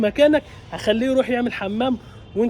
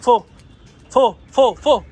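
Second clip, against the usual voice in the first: loud, urgent order to a dog; urgent loud command; 3 semitones higher, 8 dB louder than usual. Real, not acted. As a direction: urge the dog up with sharp, raised commands. Urging dog up the wall. (أمر بصوت عالي ومستعجل)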